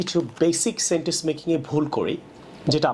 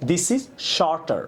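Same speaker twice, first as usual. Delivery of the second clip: slow demo of right sentence; 2 semitones higher, much slower than usual. Shown as a demonstration. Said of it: "হবে".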